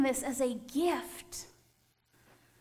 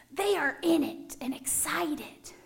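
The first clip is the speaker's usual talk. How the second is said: breathy whispered aside; 3 dB quieter than usual. Acted. Preacher whispering to the congregation. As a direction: drop to a whisper for emphasis.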